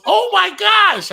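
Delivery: high, loud, slow "Oh my gosh"; the girl's high, loud shock at the yolk; shocked gasp. Retold or imitated. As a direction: shriek it out, stunned. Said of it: "The girl goes".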